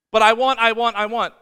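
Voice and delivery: loud, petulant repeated demand; whiny wanting. Retold or imitated. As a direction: insist like a toddler. Voicing selfish wanting.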